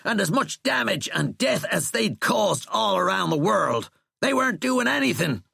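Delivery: high, heated, loud tirade; loud argument. A narrator's reading. General telling the president their attack failed, loud. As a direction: argue at full pitch, pressing each point. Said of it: "Sheckley said loudly".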